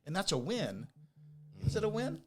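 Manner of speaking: ordinary talk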